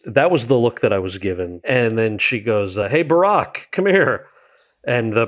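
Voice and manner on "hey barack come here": higher-pitched imitated summons; calling him over. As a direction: call your husband across the room, lightly acted. First Lady summons husband, retold.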